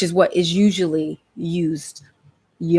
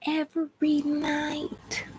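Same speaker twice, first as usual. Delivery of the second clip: high, very soft and slow; slow, high, hushed echo; hushed disbelief; 9 semitones higher, 10 dB quieter, much slower than usual. Acted. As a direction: stretch it out in a tiny high voice. Drawn-out echo of her own phrase.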